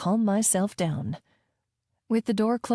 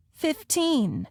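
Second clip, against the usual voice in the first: put-on bargaining child; 8 semitones higher than usual. A narrator's reading.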